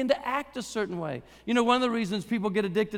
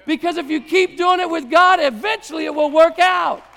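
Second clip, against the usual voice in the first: loud, rising exhortation to keep going; fired-up sermon push; preaching at full force; 6 semitones higher, 9 dB louder than usual. Real, not acted. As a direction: drive it home to the congregation.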